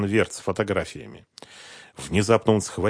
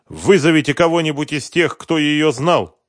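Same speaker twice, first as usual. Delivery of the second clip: loud, higher, dragging on the last words; insistent suggestion; 8 semitones higher, 9 dB louder than usual. A narrator's reading. Husband passing the identification to someone else, insistent. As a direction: push the task onto others.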